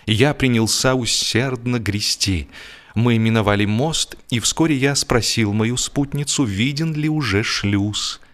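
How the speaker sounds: plain reading voice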